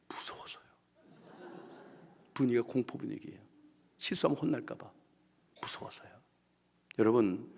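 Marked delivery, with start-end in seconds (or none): 0.1-0.6 frightened whisper. Retold or imitated. Timid member answers. 5.6-6.1 frightened whisper. Retold or imitated. Timid member answers.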